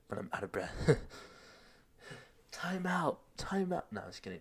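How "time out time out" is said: high, drawn-out playful plea; mock time-out call. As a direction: call for a break in a high joking voice.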